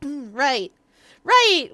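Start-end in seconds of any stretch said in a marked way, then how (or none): 1.2-1.7 high, loud exclamation; sudden recollection. Real, not acted. Streamer jolted back to the court case, exclaiming.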